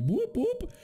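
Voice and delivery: high siren-like whoop; alarm voice. Retold or imitated. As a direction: imitate a high alert blip. Imitating radar alert.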